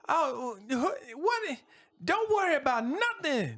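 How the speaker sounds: very high, anxious reassuring voice; smooth things over nervously; nervous mayor. Acted. Mayor brushing off Skiff's questions, anxious and high. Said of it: "the mayor goes".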